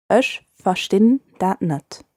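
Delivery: slow, careful phrase for learners; said slowly. Shown as a demonstration. Teacher repeating it slowly for listeners. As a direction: spell it out slowly so they can follow. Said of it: "I'll say it more slowly".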